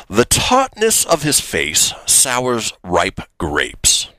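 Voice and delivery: put-on slow upper-class British accent; posh British accent, Shakespearean insult. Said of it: "I'm gonna do it in my best British accent".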